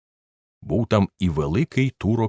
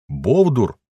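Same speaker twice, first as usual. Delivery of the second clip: louder, higher scornful jeer; scornful insult; 5 semitones higher, 5 dB louder than usual. Acted. Third friend insulting the narrator, loud scorn. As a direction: throw the insult at him.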